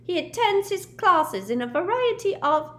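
high, squeaky elf voice; house-elf Dobby. A narrator's reading. Dobby reporting to Harry in a squeaky elf voice. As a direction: pipe it up like an eager little house-elf.

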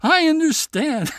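high, loud eager I understand; king pretending to understand; king bluffing. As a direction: pretend to get it.

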